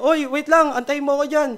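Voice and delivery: called out high and loud, half acted; retold shout. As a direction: yell after her to wait, storyteller style. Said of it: "tapos sumigaw siya".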